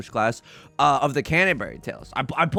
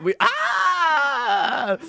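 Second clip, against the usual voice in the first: anguished cry; 12 semitones higher than usual. Real, not acted. Frustration at the hand.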